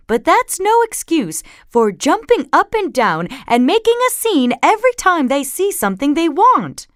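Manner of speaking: high, loud exasperated lecture; fed-up mom, voice climbing; scolding mother. A narrator's reading. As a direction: rant about the children's behavior. Mama scolds about the cubs' behavior.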